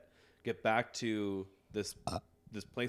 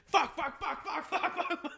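laughing cursing. Real, not acted. Laughing expletives.

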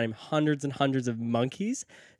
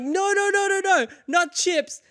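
very high squealed protest, hammed-up; frantic mock correction; 3 dB louder than usual. He corrects the stampeding crowd, frantic mock squeal. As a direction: plead in comic high-pitched panic.

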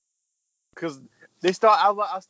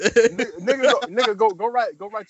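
loud, higher egging on; excited urging; 5 semitones higher, 7 dB louder than usual. Retold or imitated. Urging [name] to go write a verse, loud excited egging on. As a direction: push him to go rap now.

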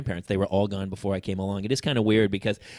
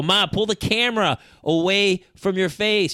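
raised, slowed, high call to a mother who can't hold the phone; exasperated call to mother; 9 semitones higher, 5 dB louder, slower than usual. Retold or imitated. Son calling out to his ninety-two-year-old mother on FaceTime, exasperated. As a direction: spell it out loud and slow for an old lady.

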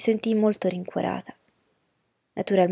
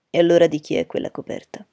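stern father questioning; 6 dB louder than usual. A narrator's reading. Padre severo interroga la figlia.

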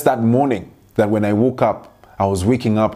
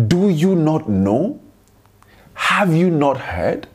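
slow, emphatic, higher questions; rhetorical rebuke; 6 semitones higher, slower than usual. Acted. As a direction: draw each question out like a prophet's rebuke. Reading Isaiah's rebuke aloud.

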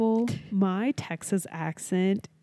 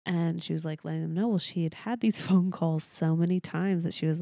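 usual speaking voice

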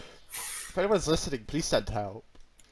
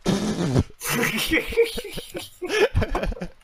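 long helpless laughter; 10 semitones higher, 4 dB louder than usual. Real, not acted. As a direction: break up laughing at the segue. Laughs at the clumsy segue.

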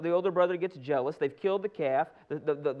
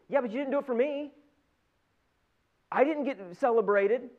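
higher, louder acted protest; indignant complaint; 6 semitones higher, 4 dB louder than usual. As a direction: argue back like a slighted son. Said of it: "what is his whole complaint".

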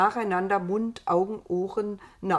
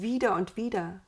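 plain reading voice

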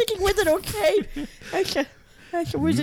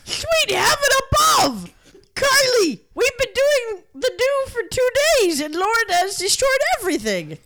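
shrill sobbing outrage; 7 semitones higher than usual. Acted. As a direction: wail it like a soap heroine. Acted dramatic outburst.

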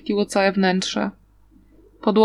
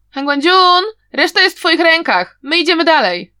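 loud call over the shoulder, higher; calling back to Han Guangjun as he runs off, loud shout; 7 semitones higher, 6 dB louder than usual. Acted. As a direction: yell it back to the man behind you. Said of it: "krzyknął Wei Wuxian".

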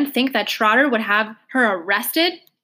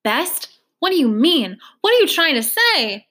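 louder and higher, building outrage; alarmed disbelief; 5 semitones higher, 3 dB louder than usual. Acted. Trotter confronting the officer, rising into alarmed disbelief. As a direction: rise from a question into alarm.

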